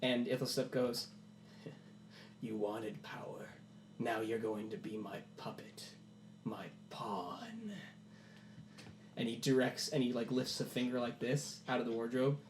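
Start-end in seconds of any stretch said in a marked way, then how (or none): 2.4-7.7 sinister puppet master. Acted. Ithelstep claims Percival as his puppet, sinister whisper. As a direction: whisper it slowly, relishing control.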